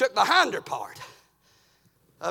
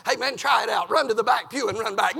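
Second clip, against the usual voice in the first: normal talking voice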